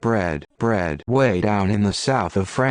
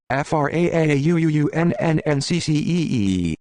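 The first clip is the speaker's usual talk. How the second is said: FRAAUUUNNNCCEE stretched out, pitched well up; aggressive France; 6 semitones higher than usual. Retold or imitated. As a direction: drag every letter out with a mock aggressive whine. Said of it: "An aggressive".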